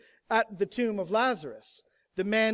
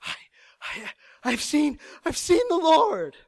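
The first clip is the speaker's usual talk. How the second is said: breathless, stammering excitement, climbing high and loud; out-of-breath cry building to a high shout; gasping for breath; 9 semitones higher, 5 dB louder than usual. Shown as a demonstration. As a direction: burst in gasping with astonishing news. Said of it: "she's gasping for breath".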